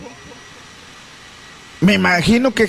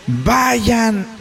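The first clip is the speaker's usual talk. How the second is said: slow, drawn-out raised order; a hint of a call across the road; commanding call; 4 semitones higher, much slower than usual. Jesus ordering the lepers to the priests, slow. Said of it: "les gritó".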